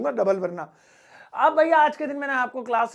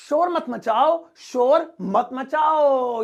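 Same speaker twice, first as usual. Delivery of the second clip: teacher's shush; 3 semitones higher, 3 dB louder, much slower than usual. Shown as a demonstration. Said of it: "next वाला है".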